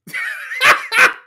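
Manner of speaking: high, shouting burst of laughter; real loud laughing shout. Real, not acted. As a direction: crack up out loud. Big reaction to crude joke.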